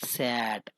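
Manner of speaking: सैट said with the open ae of "cat"; सैट, set down quietly and clearly; teacher gives the correct सैट; model pronunciation. Shown as a demonstration. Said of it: "मैं कह सकता हूँ कि".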